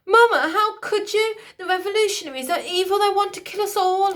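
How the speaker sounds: put-on high, loud distraught boy; young prince's loud, fast exclaiming. A narrator's reading. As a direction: cry out at his mother in shock. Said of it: "he exclaimed".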